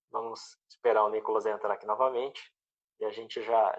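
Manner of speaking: ordinary talk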